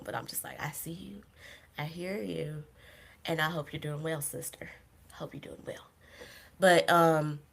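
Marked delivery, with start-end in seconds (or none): 0.6-5.9 whispered, much softer; silent inner greeting. Retold or imitated. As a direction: breathe it like a secret blessing.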